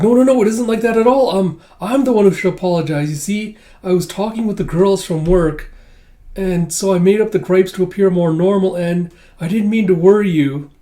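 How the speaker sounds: high, loud, hasty denial easing off; flustered apology. Retold or imitated. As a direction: protest anxiously, then explain yourself. Yor hastily denies, then apologizes.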